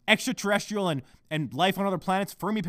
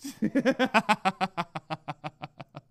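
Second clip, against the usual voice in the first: long, trailing laugh; gloating chuckle. Real, not acted. Podcaster enjoying his fiancée's annoyance, long gloating chuckle. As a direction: chuckle at getting under her skin.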